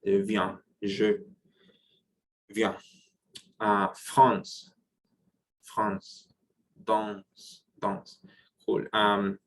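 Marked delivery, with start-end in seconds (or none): 0.1-8.2 "viens", "France", "danse" each with nasal vowel; nasal review drill. Shown as a demonstration. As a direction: run through the nasal words slowly. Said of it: "We just say it like it is".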